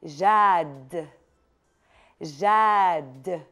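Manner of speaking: each sound drawn out very slowly; slow clear dictation. Shown as a demonstration. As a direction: let every letter ring out slowly. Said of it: "حاضرين؟".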